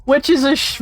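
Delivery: ordinary talk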